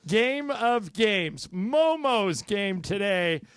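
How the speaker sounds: slow drawn-out announcer call. Acted. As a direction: stretch it out like a show host. Host announces segment, drawn out.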